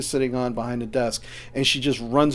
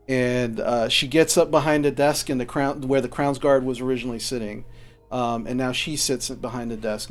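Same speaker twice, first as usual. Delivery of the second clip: normal talking voice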